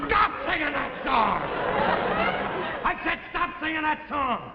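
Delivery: high, angry shout; barked order. Acted. Husband orders wife to stop singing, angry bark. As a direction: bark it like a furious command. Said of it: "stand there and bark".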